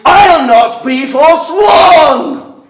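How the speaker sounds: high, loud and slow; slow furious vow. Acted. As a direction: spit out the final oath. Father's final vow.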